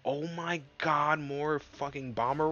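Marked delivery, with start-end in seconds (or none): none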